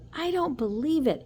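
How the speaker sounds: high loud exclamation; exclaiming. Acted. Son refusing to believe his father about Izzy, exclaiming. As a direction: burst out in disbelief. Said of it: "exclaimed Encyclopedia".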